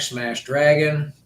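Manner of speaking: normal talking voice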